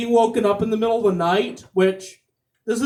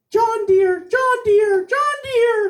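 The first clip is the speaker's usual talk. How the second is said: high sing-song calls climbing higher each time; panicked wife calling; 13 semitones higher, slower than usual. Acted. Wife shaking husband awake at three in the morning, high rising sing-song calls. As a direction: call him awake, rising in pitch.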